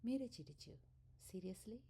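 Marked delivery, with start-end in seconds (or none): 1.3-1.8 breathy rising disbelief; incredulous question. Acted. Meera laughing at her father, incredulous rising question. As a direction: ask it as if you can't believe it.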